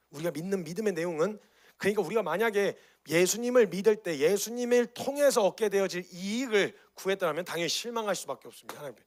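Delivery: loud, high fervent preaching; preaching at full force. Real, not acted. Preacher urging the church about faith, fervent. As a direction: drive the point home to the church.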